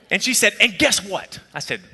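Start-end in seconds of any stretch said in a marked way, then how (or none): 0.6-1.4 imitating a worked-up sister; high, indignant build-up to a revelation; mock-outraged, pitched high; angry. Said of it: "I mean, she was angry".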